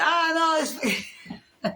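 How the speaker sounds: put-on high laughing "oh no" from the kids; laughing mock protest. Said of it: "And they said".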